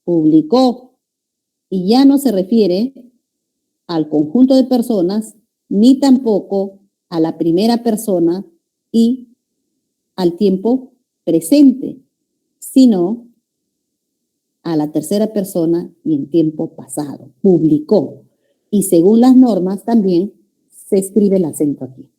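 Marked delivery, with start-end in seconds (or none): 0.0-1.0 "publicó" stressed on the final CÓ; pu-bli-CÓ, weight on the ending; stress on final syllable. Shown as a demonstration. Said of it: "puede aparecer también al final". 17.4-18.2 "Publicó" with the weight on CÓ; low-pitched "Publicó", stressed at the end; pu-bli-CÓ, last syllable strong; stress on final syllable. Shown as a demonstration. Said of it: "puede aparecer también al final".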